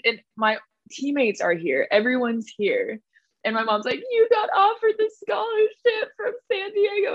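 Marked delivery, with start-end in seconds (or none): 4.1-7.1 high, tearful mom breaking the news; imitating crying mom. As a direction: mimic mom choking up with happy tears. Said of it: "she's just bawling".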